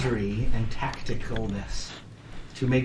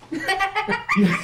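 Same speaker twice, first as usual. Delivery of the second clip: burst of laughter. Real, not acted. Laughing while setting the board.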